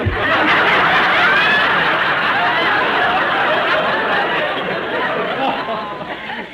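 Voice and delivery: long, very high, loud peal of laughter; big laugh. Acted. Husband reacting to the teasing, loud laughter. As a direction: let a hearty laugh roll out.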